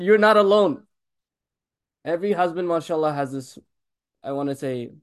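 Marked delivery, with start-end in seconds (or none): 0.0-0.8 louder, punchy reassurance; retelling his reply to the crying brother, emphatic. Retold or imitated. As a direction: tell the brother firmly he's not alone. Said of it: "I said".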